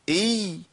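إي held as a long ee with no following vowel; still ya. Shown as a demonstration. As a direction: isolate and sustain the ee of إي. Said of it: "أدي ياء ساكنة".

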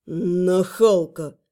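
very slow, low drawl; drawn out. Acted. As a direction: stretch the word out mockingly. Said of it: "протянул дракон".

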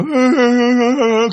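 high mock sobbing; drawn-out put-on crying wail; imitated wailing. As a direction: imitate bawling like fresh grief. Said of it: "khóc như vừa mới chết".

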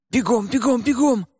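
plain reading voice

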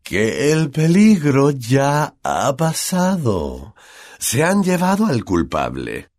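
stretched-out words, as if to dimwits; very slow, condescending. A narrator's reading. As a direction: spell it out slowly and patronisingly. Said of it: "hablándoles muy despacio como si fueran tontos".

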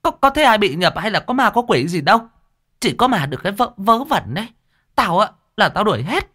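high, sharp landlady scolding; angry scolding. Acted. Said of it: "cô Thuận quát lên".

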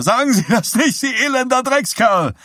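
desperate hoarse shout; hoarse scream. A narrator's reading. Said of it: "schrie Richardson heiser".

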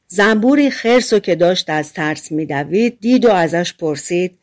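plain reading voice

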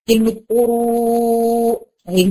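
قروء with the long waw drawn out before the hamza; قروء بمد الواو; stretched madd. Shown as a demonstration. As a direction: slowly hold the waw in قروء. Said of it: "مد بالواو".

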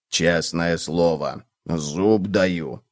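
low, slow, quiet oath; low plodding cockroach voice; earnest cockroach. A narrator's reading. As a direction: swear it solemnly, low and soft. Cockroach character swears it is true.

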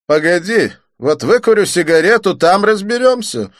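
high, loud unhurried reply; casual and unbothered; Didier's voice. A narrator's reading. Didier answers the thug, unhurried.

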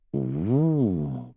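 long hummed "oo" that swells up and falls; appreciative hum. A narrator's reading. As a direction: hum a drawn-out, pleased ooh. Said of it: "промычала Арадия и улыбнулась".

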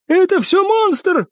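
very high, drawn-out frantic cry; panicked cry. A narrator's reading. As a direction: wail it in panic, pitch way up. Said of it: "вскочил на ноги курсант".

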